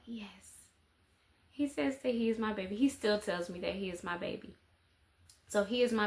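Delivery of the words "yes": tiny whispered yes, imitating a little boy; child's whispered reply. Son answering mother's "are you my baby", imitated in a whisper. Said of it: "And he'll be like".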